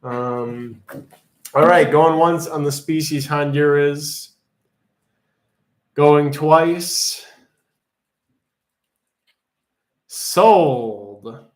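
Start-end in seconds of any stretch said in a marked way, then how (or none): 1.5-11.4 loud auctioneer countdown; auctioneer call. Acted. Closing an auction.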